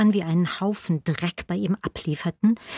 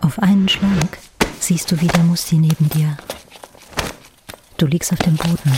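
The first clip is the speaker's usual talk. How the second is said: breathy whispered aside; hushed whisper of memory; 5 dB louder than usual. Acted. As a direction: whisper it close to the ear. Flashback to arrest, intimate narration.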